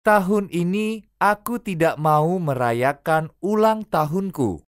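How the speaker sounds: usual speaking voice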